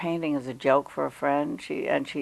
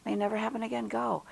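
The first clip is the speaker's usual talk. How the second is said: soft, higher-pitched quick urging; urging mentor; 5 semitones higher, 6 dB quieter, faster than usual. Retold or imitated. Older colleague urging her to take the job, soft insistence. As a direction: gently push her to go, voice lifted. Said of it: "and she said".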